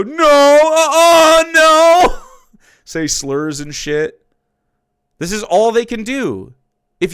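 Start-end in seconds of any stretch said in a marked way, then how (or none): 0.0-2.2 very high, loud, drawn-out whiny no; mocking denial. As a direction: whine it like a stubborn child, hammed up. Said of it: "all they're gonna do is go".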